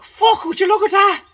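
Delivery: high, loud put-on voice of a stunned drunk mate; imitating a drunk friend gawping; awestruck exclamation. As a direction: blurt it out in wonder at the sight above. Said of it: "Biddy's like".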